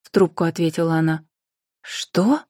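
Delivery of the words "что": short, higher-pitched startled question; rising, taken-aback question; surprised. A narrator's reading. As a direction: react with surprise to news on the phone. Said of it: "Анфиса удивлённо раскрыла глаза".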